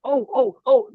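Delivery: high, eager interjection; sudden excitement. Real, not acted. Co-host breaking in on remembering something, real excitement. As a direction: burst in with a sudden memory.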